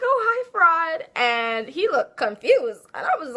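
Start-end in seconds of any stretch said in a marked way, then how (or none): 0.0-1.1 high, slow, sugary "Oh, hi, fraud"; sing-song fake-sweet greeting; high drawn-out mock politeness; sarcastic hello.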